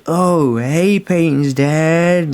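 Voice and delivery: slow, slurred and high-pitched drugged greeting; sounding really high. Retold or imitated. Friend greeting Peyton's dad while high, slow slurred drawl. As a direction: drawl it out like you're barely conscious. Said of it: "like, really fucked up sounding".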